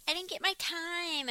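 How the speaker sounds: high, soft whiny imitation. Podcaster mimicking a contestant at cocktail hour, whiny. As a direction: mimic her complaining in a high little whine. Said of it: "she was, like, really whiny".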